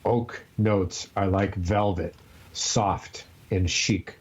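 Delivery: slow, low, each word drawn out; over enunciate it very slowly; over enunciating. Shown as a demonstration. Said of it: "Let's say it together".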